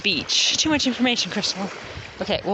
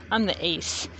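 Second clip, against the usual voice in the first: normal talking voice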